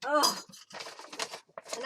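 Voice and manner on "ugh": normal talking voice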